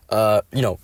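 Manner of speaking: normal talking voice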